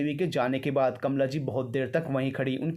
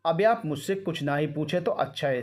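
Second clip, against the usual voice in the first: usual speaking voice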